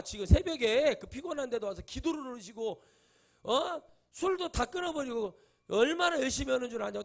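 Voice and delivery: high, loud nagging retold with heat; scolding wife. Officer's wife berating her husband about church, high and loud. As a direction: press him hard like an exasperated wife.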